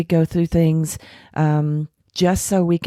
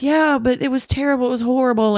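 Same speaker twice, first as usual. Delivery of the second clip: higher, put-on upset voice; faked distress; 8 semitones higher than usual. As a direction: play up the misery to sell the lie. Feigning distress after fake story.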